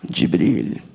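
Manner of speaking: very low, measured regal voice; prince's voice. Retold or imitated. Prince addressing the comic by name, low and regal. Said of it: "And he's like".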